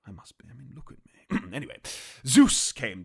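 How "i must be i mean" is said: plain reading voice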